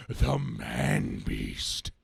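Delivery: deep, slow drawn-out monster name; dramatic villain name. Acted. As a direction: growl out the name like a beast.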